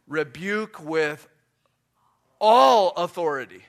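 slow, with ALL bursting out loud; pause, then hit "all" with full authority; firm authority. Shown as a demonstration. Preacher quoting the passage on rebuking, firm authority. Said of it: "I need to do it with a firmness".

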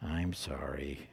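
put-on a girl forced to apologize to her brother, muttering; muttered sorry. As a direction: mumble it under your breath, unwilling. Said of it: "the girl just mutters something".

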